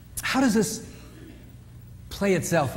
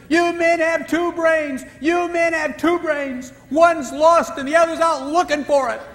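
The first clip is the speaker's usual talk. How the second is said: high, loud, slow mock scream; mock screaming wife; 11 semitones higher, 5 dB louder, slower than usual. Wife screaming at her husband over the diet book, high mock screech. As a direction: screech it at him, finger pointed. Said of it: "she's screaming at him".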